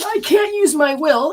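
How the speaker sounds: a hint of a resistant inner child; voicing a part of you. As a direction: pitch it up like a whiny inner voice. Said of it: "a part of you that says".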